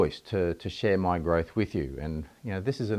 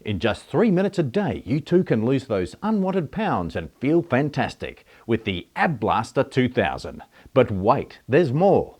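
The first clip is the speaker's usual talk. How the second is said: loud, higher-pitched mock telly-ad pitch; hammed-up TV salesman voice; TV infomercial announcer; 4 semitones higher, 5 dB louder than usual. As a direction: sell it like a cheesy infomercial, drawn out and punchy. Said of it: "we've all seen those ads on telly".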